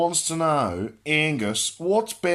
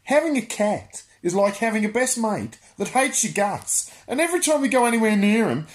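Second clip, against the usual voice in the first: ordinary delivery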